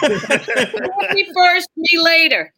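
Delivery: slow, high teasing purr laughing through it; joking as a starlet, drawn out and laughing; mock sultry offer. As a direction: ham up a casting-couch vamp. Mock seductive joke line.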